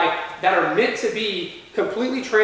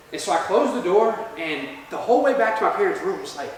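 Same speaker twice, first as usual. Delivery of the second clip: usual speaking voice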